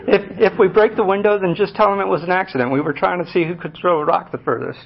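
lightly voiced reckless older brother, amused; brother's carefree reply. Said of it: "He said".

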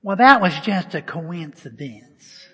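loud, slow put-on voice; mocking sceptic. Pastor voicing a doubter to the congregation, mockingly. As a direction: drag it out as a scoffing dismissal.

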